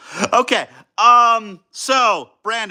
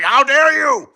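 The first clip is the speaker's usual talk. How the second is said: hammed-up offended gasp, higher and louder; mock outrage; 7 semitones higher, 5 dB louder, slower than usual. Streamer imitating an offended Total War fan, mock indignant. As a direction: play the insulted Total War fan, half-joking. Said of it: "they're like".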